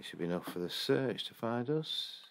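ordinary delivery

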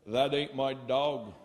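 dry farmer punchline, low and slow; Vermont farmer. Acted.

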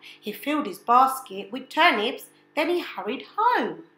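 ordinary delivery, nothing marked

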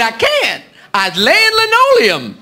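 slow, drawn-out caricature voice; joke friend. Acted. Tevis turning down Rufus on the phone, slow caricature drawl. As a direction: stretch the excuse out, swooping high.

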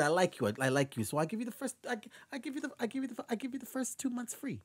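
high, soft Yiddish landlord; Yiddish landlord impression. Landlord offering tenants free rent, Yiddish-accented impression. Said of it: "but he said".

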